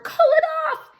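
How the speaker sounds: high, loud strangled cry; croaked plea. Acted. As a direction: choke it out as the tail tightens. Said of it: "he croaked".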